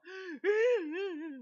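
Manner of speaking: a sudden high sobbing gasp. Retold or imitated. Pastor mimicking the kids bursting into sobs, a high sobbing gasp. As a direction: gasp out a sob like a crying child.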